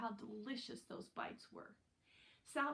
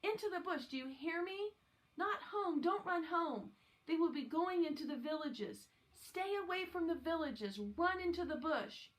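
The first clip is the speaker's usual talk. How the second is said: urgent; 6 semitones higher than usual. Acted. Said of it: "his voice low and urgent".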